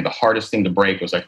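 normal talking voice

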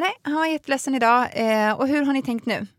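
pushy teacher. Retold or imitated. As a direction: press her point like the teacher. Pedagog voiced in retold story. (härmad påstridig pedagog, ljusare)

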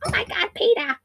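high-pitched Lois voice calling Peter; Lois impression. Mimicking the actress as Lois calling Peter, high-pitched. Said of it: "She sounds like Lois on Family Guy".

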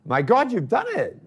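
high, excited congratulation; overjoyed. Retold or imitated. [name] retelling his delight hugging Tommy Burns at Hampden. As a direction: burst out with joy for him. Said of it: "I'm like".

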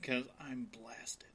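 slow whispered aside; whispering. Real, not acted. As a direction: drop to a breathy whisper. Joking confession to co-hosts.

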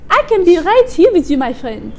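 mock French charm to a stranger; French accent. Sister playing a French local, in a hammed-up French accent. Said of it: "this is the French accent".

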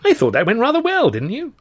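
high, loud self-satisfied delight; pleased. A narrator's reading. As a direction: fish for praise, beaming. Luscombe pleased with his act.

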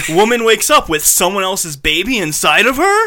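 scandal-sheet headline as a question; tabloid headline. Acted. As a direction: blare it out like a tabloid shocker, rising at the end. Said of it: "what you would see on a tabloid".

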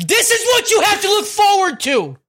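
high, loud hammed-up parental shout; comic yelled warning to a kid; mock dad yell. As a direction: holler it like an exasperated parent, half laughing. Voicing dad lecturing his kid.

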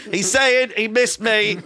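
high, loud, slow over-the-noise explanation; explaining to the audience above the bear show, loud and slow; loud translation. Retold or imitated. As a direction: shout it slowly over the rap.

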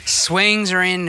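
ordinary talk, nothing marked

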